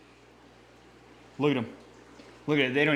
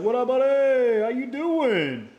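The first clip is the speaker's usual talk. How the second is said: higher, louder playful fish voice, slowing down; voicing the fish; 8 semitones higher, 5 dB louder than usual. Voicing the new fish greeting its tankmate, playful and chummy. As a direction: greet your new tankmate like a cheerful dude. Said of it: "he's like".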